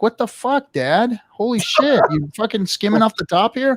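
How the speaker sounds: high, whiny mock outrage of a son; mock son accusing. Dad imitates his son accusing him of skimming, high and indignant. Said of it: "he was like".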